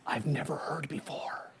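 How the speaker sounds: hushed low whisper. Acted. Teasing the crowd with a secret, whispered. Hushing the audience.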